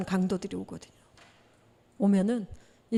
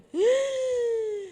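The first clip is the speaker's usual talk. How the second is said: sharp gasp; 11 semitones higher than usual. Retold or imitated. Retelling the near-death shock.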